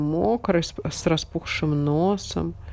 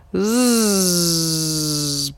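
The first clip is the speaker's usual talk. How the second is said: offended buzzing; bee buzz. A narrator's reading. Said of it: "прожужжала обиженная пчела".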